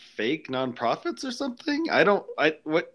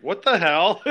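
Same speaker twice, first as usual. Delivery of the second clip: surprised; 8 semitones higher, 5 dB louder than usual. Retold or imitated. Shocked when the caller hangs up.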